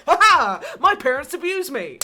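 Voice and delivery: high, loud fake-cheery cry; hammed-up cheer; mock cheerful. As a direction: announce it brightly, high and loud. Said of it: "I'm just like".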